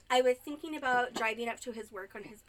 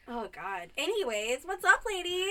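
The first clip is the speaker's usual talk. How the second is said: usual speaking voice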